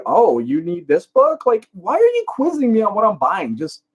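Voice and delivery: high, put-on voice of the nosy cashier; mock cashier quiz. Narrator mimicking the nosy thrift store cashier. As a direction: imitate the cashier who grills you. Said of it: "she's, like, going".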